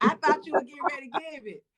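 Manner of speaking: words broken by laughter; laughing. Real, not acted. Host laughing at Calvin's answer, laughing through.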